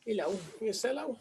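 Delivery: soft, high-pitched cooing to a dog; puppy voice. Real, not acted. Owner saying hello to the puppy, in puppy voice.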